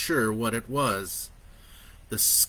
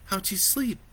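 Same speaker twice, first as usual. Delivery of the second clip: higher, soft motherly question; gentle mother; 8 semitones higher, 3 dB quieter than usual. A narrator's reading. Mother asking her son in bed, gently. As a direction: ask kindly, lightly.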